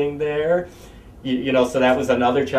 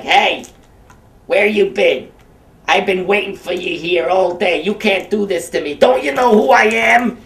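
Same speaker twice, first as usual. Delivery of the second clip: high, loud put-on puppet voice, indignant; Humpty puppet scolding a kid, dopey Clinton-esque voice; dopey Humpty Dumpty voice; 7 semitones higher, 5 dB louder than usual. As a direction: play the dopey egg, offended at being kept waiting. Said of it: "that's his voice".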